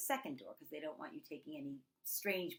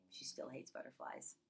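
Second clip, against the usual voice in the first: hushed; 5 semitones lower, 11 dB quieter than usual. Real, not acted. Quiet story ending aside.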